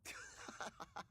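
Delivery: high, laughing concession; amused laughing. Acted. Swordsman amused by companion's warning, laughing. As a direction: break into laughter around the words.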